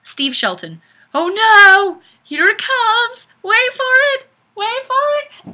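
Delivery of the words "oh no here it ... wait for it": much higher, louder hammed-up alarm; mock panic. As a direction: squeal it like doom is coming. Mock panic reading comment.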